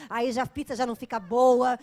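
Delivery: suddenly loud, fed-up complaint; exasperated outburst. Real, not acted. Exasperated rant about anger. (desabafo alto e irritado)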